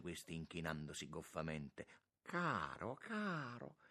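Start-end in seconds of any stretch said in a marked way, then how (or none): 2.2-3.8 high-pitched fond greeting; affectionate monsignor. A narrator's reading. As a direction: coo fondly to an old friend.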